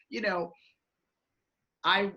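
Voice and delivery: ordinary talk, nothing marked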